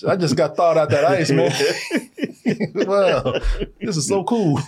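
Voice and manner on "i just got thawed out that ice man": usual speaking voice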